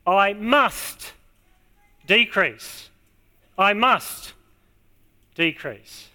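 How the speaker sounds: MUST held out, a pause, then "decrease" falling away; stress on MUST. Shown as a demonstration. Preacher shows the congregation the key word, stressing "must". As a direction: lean on "must" each time, pausing before "decrease". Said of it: "I'll put the emphasis on the right word here".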